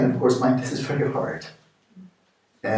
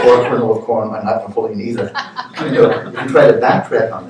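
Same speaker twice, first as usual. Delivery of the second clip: talking through laughter, louder; laughing; 10 dB louder than usual. Real, not acted. Teacher laughing through jokes to students. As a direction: keep laughing as you add the joke.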